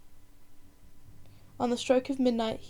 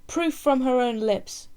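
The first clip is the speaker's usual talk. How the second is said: slow, louder accusing cry; mock scream; 2 semitones higher, 6 dB louder, slower than usual. Chief advisor accusing the woman before the crowd, a slow loud cry. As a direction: hammer home the accusation. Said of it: "screamed the chief advisor again".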